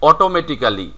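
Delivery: "অটোমেটিক্যালি" with a full "kaa" before "li"; every syllable of "অটোমেটিক্যালি" sounded; local "অটোমেটিক্যালি". Shown as a demonstration. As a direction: say "অটোমেটিক্যালি" as oto-meti-KAA-li. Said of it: "ছোটকাল থেকে আমরা শিখে আসতেছি".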